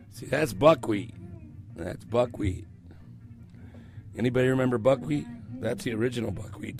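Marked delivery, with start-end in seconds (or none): none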